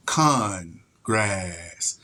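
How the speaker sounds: "Con grass" slow, low, split into two words; drawn out "Con grass", punching con; wordplay. Shown as a demonstration. Repeating the pun to viewers, slow and low. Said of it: "That's how they speak".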